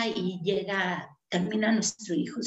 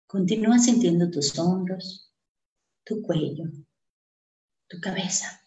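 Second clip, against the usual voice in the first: guided meditation; 3 semitones lower than usual. Acted. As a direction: lead them gently into calm breathing.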